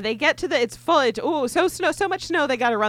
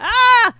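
hammed-up scream; 11 semitones higher, 10 dB louder than usual. Acting out cry in woods.

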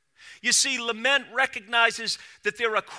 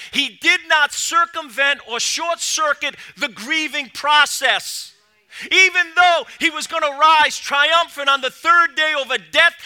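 raised, louder sermon voice building to a peak; fervent preaching; 5 semitones higher, 8 dB louder than usual. Acted. Preacher to congregation, driving the point home, loud and fervent. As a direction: preach it out full force to the congregation.

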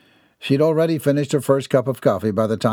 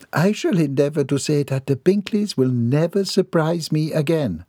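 higher, prim Southern lady; ladylike voice; 6 semitones higher than usual. Acted. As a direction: speak as a proper, composed matron.

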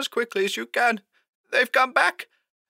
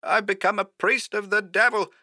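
high, strained confession; anguished high-pitched telephone voice; hoarse, despairing; 12 semitones higher, 3 dB quieter than usual. A narrator's reading. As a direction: confess it in a thin, high, frightened voice. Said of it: "more hoarsely this time".

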